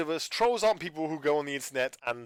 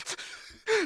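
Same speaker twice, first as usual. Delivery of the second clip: laughing. Real, not acted. Laughing after retold snap.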